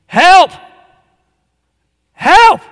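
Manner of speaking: high, loud yell for help; demonstrated yell. Shown as a demonstration. Preacher showing congregation how to call to Christ, demonstrated yell. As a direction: yell it out, one bursting cry at a time. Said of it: "You have to yell for help".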